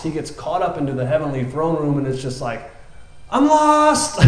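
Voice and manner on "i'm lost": much higher, loud squeal of panic; shrill-free but frantic high cry of being lost; hammed-up panic. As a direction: play a prophet freaking out. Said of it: "freaking out".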